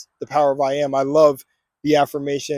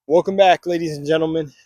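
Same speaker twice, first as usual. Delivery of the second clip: usual speaking voice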